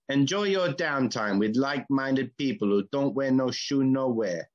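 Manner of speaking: hammed-up Jamaican accent ad read. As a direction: sell it like a laid-back Jamaican radio ad. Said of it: "I've gotta do it in a Jamaican accent".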